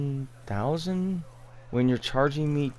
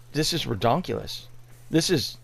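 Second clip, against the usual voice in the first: usual speaking voice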